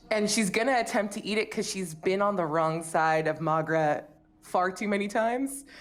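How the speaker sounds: usual speaking voice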